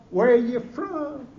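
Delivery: loud, slow, drawn-out imitation of a Northerner; Northerner's question. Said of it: "what do they say to you".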